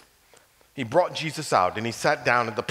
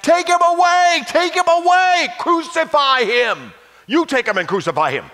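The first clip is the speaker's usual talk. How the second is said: crowd shout; 11 dB louder than usual. Acted. The crowd shouting back at Pilate, loud high yell. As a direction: roar it like a crowd demanding death. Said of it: "But they shouted back".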